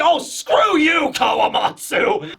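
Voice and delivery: drawn-out, high mock outrage; slow, exaggerated curse at a comrade; indignant retort. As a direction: stretch it into a hammed-up cry of annoyance. Raizo's angry retort, acted.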